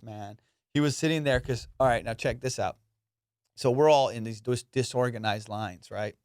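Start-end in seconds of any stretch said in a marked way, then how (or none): none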